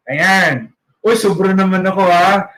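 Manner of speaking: ordinary talk, nothing marked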